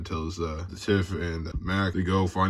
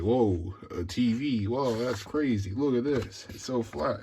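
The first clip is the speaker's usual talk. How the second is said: higher, hushed imitation of wonder; mock amazement; 4 semitones higher, 4 dB quieter than usual. Acting out time travelers seeing a TV, mock amazed. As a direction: lightly act out gawking at a marvel. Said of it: "then they're all amazed".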